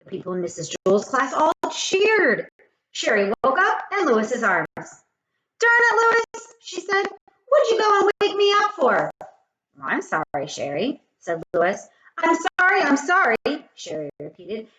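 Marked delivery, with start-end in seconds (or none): 4.8-9.1 high, loud huffy complaint; mock annoyed girl. Sherry scolding Lewis for waking her. 9.8-11.0 sheepish man. Acted. Lewis apologizing to Sherry, low and sheepish. 12.2-13.7 high, loud sing-song mimicry; mock his apology back at him; mocking repeat. Sherry mimicking Lewis's apology, teasing.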